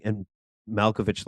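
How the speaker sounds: usual speaking voice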